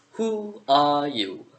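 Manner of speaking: slow, drawn-out caterpillar voice. Acted. Vaping caterpillar questions a stranger in the forest, slow caterpillar voice. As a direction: draw each word out like a lazy caterpillar.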